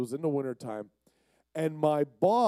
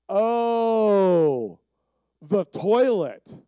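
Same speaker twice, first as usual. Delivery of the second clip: drawn-out, higher oh of recognition; surprised realization; 5 semitones higher, 8 dB louder, much slower than usual. Retold or imitated. Florist realizing he came for the toilet, surprised. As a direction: let it dawn on her. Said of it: "And she's like".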